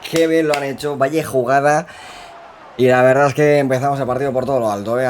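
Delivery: loud, high goal celebration call; breakneck goal commentary. Real, not acted. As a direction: shout the goal out to the viewers. Goal just scored in match.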